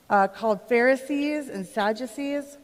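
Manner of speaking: ordinary delivery, nothing marked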